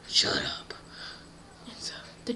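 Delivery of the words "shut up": hushed, breathy villain dismissal; Lord Voldemort. Acted. Villain silences the shocked girl, harsh whisper. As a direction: hiss it in a whisper. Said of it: "And he's like".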